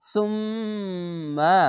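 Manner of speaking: সুম্মা with the ম held long through the nose; nasal ghunnah. Shown as a demonstration. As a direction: hum the doubled ম in সুম্মা. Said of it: "মিম জবর মা". (গুন্নাসহ সুম্মা)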